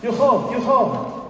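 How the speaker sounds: high, falling yo-ho calls; calling out. A narrator's reading. Bored eye calls out for fun, high playful call. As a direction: sing out a high alarm call. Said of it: "The eye called out".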